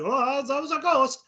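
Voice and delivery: high, slow mock gullible voice. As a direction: send up a gullible believer. Mocking a hasty conclusion.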